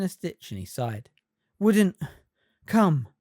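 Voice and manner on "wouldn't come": out of breath, loud gasps; panting. A narrator's reading. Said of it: "he panted".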